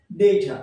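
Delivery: "ডেটা" said as "DAY-tha", aspirated t; correct pronunciation. Shown as a demonstration. Teacher giving learners the real form, "ডেটা" as DAY-tha. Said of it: "কি এটা?".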